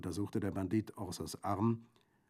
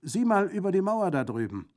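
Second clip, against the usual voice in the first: high, loud called order; calling out; 12 semitones higher, 10 dB louder than usual. A narrator's reading. Bandit sends the girl to the wall, calling loudly. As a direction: call it across to someone at a distance. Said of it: "rief Brandolaccio".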